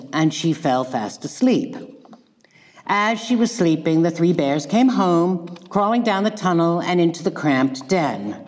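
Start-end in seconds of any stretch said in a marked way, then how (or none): none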